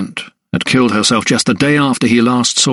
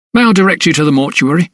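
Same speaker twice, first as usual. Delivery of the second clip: high, quick clerk's offer; obliging clerk; 6 semitones higher, faster than usual. A narrator's reading. Clerk offers to direct the doctor.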